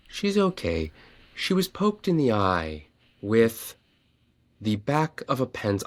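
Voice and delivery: drawn-out, unhurried nurse delivery, lightly voiced; mock-slow nurse voice breaking the news. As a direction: take your sweet time like a calm school nurse. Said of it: "as slow as she can".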